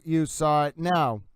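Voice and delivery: usual speaking voice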